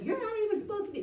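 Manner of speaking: high, fast mock scream; retold screaming. Board member Ray screaming at her, high and fast. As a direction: imitate a rapid high-pitched scolding. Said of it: "They were screaming at me".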